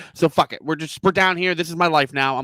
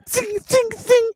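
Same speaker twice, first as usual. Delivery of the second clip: high-pitched imitated zaps; laser zing noises; slower than usual. Father imitating the laser on his son's mouth, high zinging noises. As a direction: mimic a laser going zing, high and ringing.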